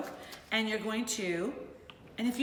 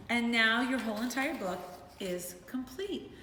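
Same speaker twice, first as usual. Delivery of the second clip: ordinary talk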